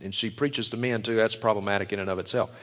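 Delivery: usual speaking voice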